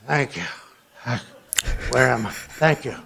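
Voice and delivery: mock confused politician, low and soft; imitating a lost speaker. Speaker mocking the president after a speech, dazed. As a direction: mimic a dazed man leaving the stage. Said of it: "he goes like this".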